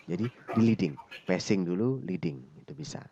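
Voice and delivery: ordinary delivery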